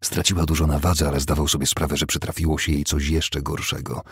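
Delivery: plain reading voice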